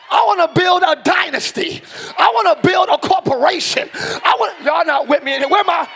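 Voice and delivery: rally the congregation at full voice; fired-up pulpit exhortation; rousing preaching. Real, not acted.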